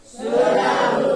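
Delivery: loud group answer; class chorus. Real, not acted. Students answer the teacher in unison, loud chorus. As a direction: everyone shouts back.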